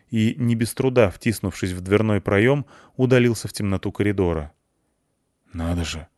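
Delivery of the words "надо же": muffled voice through a helmet; muffled under helmet. Acted. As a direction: speak as if inside a sealed suit. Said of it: "голос Андрея из-под шлема доносился глухо". (глухо из-под шлема)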